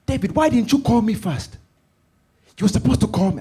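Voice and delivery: high, loud mother's rebuke; shrill-free loud high telling-off; scolding mom. Retold or imitated. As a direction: scold him at full volume.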